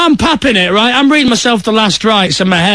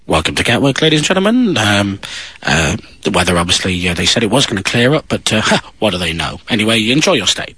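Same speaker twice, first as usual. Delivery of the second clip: lower, softer, casual pilot announcement; offhand pilot; 11 semitones lower, 3 dB quieter than usual. Retold or imitated. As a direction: shrug off the storm like a bored captain. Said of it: "just goes".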